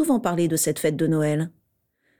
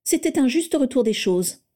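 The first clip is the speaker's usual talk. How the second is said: dry, cutting, higher voice; curt retort; 7 semitones higher than usual. A narrator's reading. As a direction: hand it back to him coldly. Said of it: "rétorqua sèchement Narcissa". (ton sec et tranchant)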